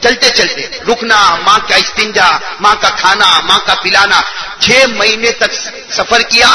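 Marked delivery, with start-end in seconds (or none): none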